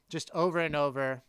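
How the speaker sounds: ordinary delivery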